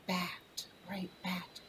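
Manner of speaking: hushed, soft confirmation; whispering. Real, not acted. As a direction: whisper the word back.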